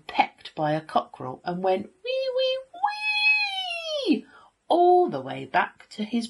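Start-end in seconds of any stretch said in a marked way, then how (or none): 2.0-4.3 high rising "wee" squeals; squeal, stretching the last one long; pig squealing. A narrator's reading. Third pig pecked by a cockerel, squealing.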